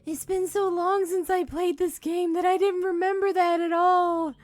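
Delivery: high, loud, rattled chatter; shaken, excited. Real, not acted. Telling chat about the scare, high and loud. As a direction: blurt it out, still buzzing with fright.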